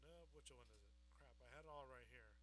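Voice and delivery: ordinary talk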